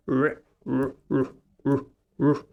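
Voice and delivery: ر with the tongue curled back, untrilled; English R. Shown as a demonstration. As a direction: hold ر as an English R, not rolled. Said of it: "تعمل لسانك". (انطق «ر» إنجليزي ولسانك لورا)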